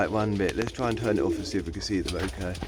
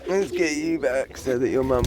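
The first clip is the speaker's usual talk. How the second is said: doting pet voice, pitched up; coaxing pet talk; 8 semitones higher than usual. Real, not acted. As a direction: coax little birds along sweetly. Coaxing the young birds.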